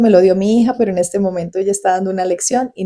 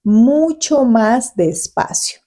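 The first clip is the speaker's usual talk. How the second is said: slow demo; 3 semitones higher, much slower than usual. Shown as a demonstration. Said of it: "tengo que hablarles".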